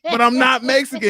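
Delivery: loud, high mock protest; retold protest. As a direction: protest loudly over the pushy stranger. Retold protest to insistent stranger.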